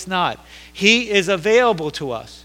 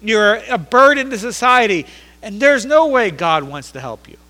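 hint of scornful onlookers, pitched higher; jeering crowd; 5 semitones higher, 6 dB louder than usual. Everybody telling Bartimaeus he is a burden, scornful. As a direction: put him down in a raised preacher's voice. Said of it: "everybody's saying".

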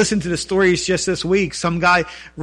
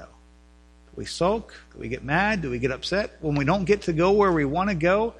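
ordinary delivery

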